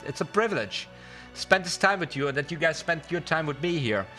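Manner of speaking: ordinary delivery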